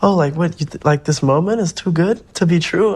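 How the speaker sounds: high mocking family voice. Family mocking his feeling unreal, high mock voice. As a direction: mimic the family brushing it off. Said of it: "they dumb ass used to be like".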